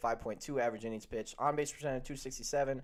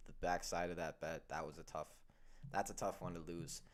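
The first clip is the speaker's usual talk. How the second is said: usual speaking voice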